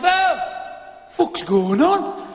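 hammed-up angry demand for an explanation; angry husband. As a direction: come home and lay into the wife. Joseph comes home in bad mood.

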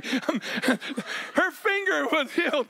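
slow, laughing emphasis. Real, not acted. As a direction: drag it out while laughing.

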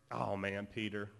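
normal talking voice

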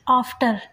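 आफ्टर with an open long आ; long aa in after. Shown as a demonstration. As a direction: stretch the आ in आफ्टर. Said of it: "ए को हम आ बोलेंगे". (आफ्टर में लंबा आ)

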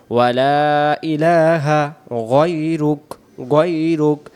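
drawn-out chanted phrase; prayer chant; slow recitation. Shown as a demonstration.

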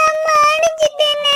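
high, slow, drawn-out wail; wailing that mother hit her; wailing cry. Acted. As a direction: cry out to your brother after the slap. Girl wails after being slapped.

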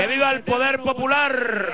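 shouted rally chant; ranting dictator speech. Real, not acted. (consigna gritada)

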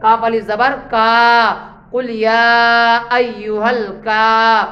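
slow, stretched spelling of each sound; slow Quran spelling drill; drawn-out recitation. Shown as a demonstration. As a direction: stretch the vowels out like a teacher. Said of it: "कैसे?".

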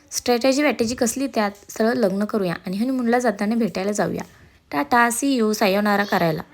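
ordinary delivery

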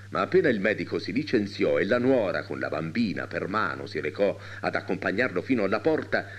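ordinary delivery, nothing marked

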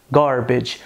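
"garbage" with an American R; American accent. Shown as a demonstration. Teacher giving the American version, American accent.

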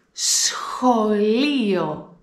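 σχολείο slow, σ plus χ clear; slow model word. Shown as a demonstration. Teacher shows the σχ cluster, slow. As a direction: spell out σχολείο with the sounds. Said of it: "Εδώ έχουμε συνδυασμό σίγμα πλας χ".

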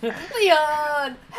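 high shouted call for help; panicked cry for help. Pretend. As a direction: yell the name like you need rescue. Calling out for help.

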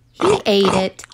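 ordinary delivery, nothing marked

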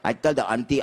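ordinary talk

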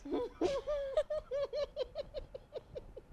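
long high cackle at his own pun; high laugh. Real, not acted. Dad-joke teller cracking up at his own pun, long high laugh.

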